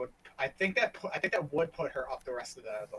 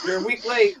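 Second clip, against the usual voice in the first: high, laughing tease; 10 semitones higher, 11 dB louder than usual. Real, not acted. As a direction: laugh out the teasing words. Teasing a late joke.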